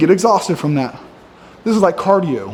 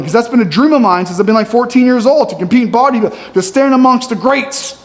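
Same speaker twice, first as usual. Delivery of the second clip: normal talking voice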